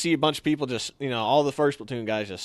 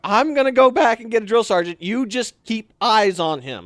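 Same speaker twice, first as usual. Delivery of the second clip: calling out; 8 semitones higher, 6 dB louder than usual. Retold or imitated. Shouting to partner mid-chase.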